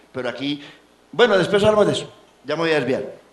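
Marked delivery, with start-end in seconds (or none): none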